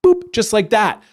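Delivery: ordinary delivery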